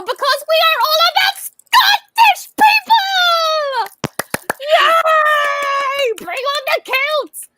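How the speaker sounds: high, drawn-out excited cheer. Acted. Host announces an all-Scottish episode to fans, very excited cheer. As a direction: whoop it up with stretched, high-pitched words. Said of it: "I'm very excited".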